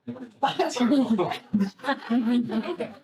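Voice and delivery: a high, embarrassed burst of laughter; real laugh. Real, not acted. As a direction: laugh it off, caught out on screen. Embarrassed on camera with family.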